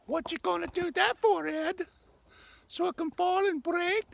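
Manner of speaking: mock-incredulous squeaky bystander voice; squeaky heckler voice. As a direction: pitch way up like a cartoon sidekick questioning him. Squeaky voice heckles his camera plan.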